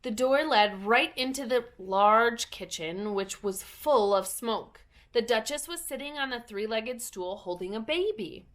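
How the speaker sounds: ordinary delivery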